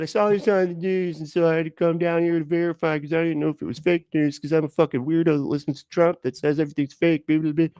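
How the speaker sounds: high-pitched mocking retelling; mocking imitation. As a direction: parrot them in exasperated mockery. Mockingly retells the video maker's words.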